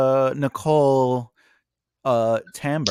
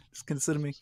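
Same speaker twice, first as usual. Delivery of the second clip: whispered aside; 10 dB quieter, faster than usual. Real, not acted. Aside during accent joke.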